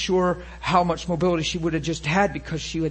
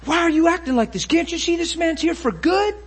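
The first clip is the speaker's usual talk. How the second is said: high, louder scolding, said fast; retold yelling; 11 semitones higher, 4 dB louder than usual. Woman yelling at a man about how he treats the visitor, high scolding. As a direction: yell it as an indignant scolding. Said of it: "yelled at him and said".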